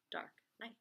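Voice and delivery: usual speaking voice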